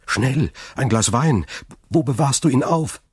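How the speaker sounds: full of worry. A narrator's reading. Son wants wine for his fainting father, worried. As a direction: call out in alarm for help. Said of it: "rief Dantès voller Sorge".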